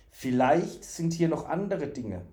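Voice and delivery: plain reading voice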